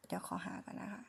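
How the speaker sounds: soft whisper to herself while looking it up; hushed aside. Real, not acted. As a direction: murmur it under your breath. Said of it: "whispered aside while searching".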